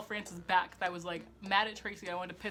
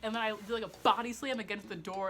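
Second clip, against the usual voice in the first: ordinary talk, nothing marked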